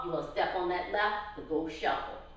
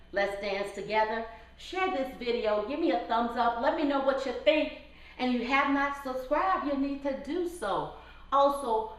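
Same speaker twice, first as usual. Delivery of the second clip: ordinary delivery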